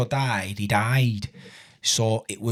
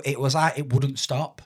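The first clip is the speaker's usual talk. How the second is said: ordinary talk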